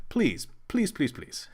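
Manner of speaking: begging, fading to a breath; gentle repeated plea, last ones whispered; pleading nicely. Real, not acted. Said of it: "I ask very nicely".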